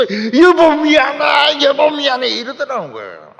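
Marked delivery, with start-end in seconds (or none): 0.0-2.3 loud, high, slow crying plea; husband wailing sorry to his dying wife; wailing apology. Retold or imitated. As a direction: howl it out like a grieving animal. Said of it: "막 울면서 짐승처럼 울면서".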